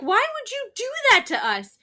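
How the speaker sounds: high, loud imitation of an outraged child; appalled protest. Mother retelling her son questioning his parents, appalled. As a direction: wail it like a betrayed kid.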